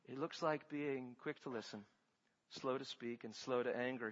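soft and low, gently spaced phrases; hushed counsel. Shown as a demonstration. Preacher shows congregation what humble listening looks like, hushed and low. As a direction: drop low and quiet for each short phrase. Models being slow to speak.

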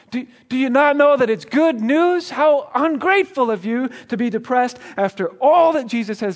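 high, loud, preachy rebuke; mocking scold. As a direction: play a self-righteous churchgoer scolding someone. Mocking churchgoers scolding the depressed.

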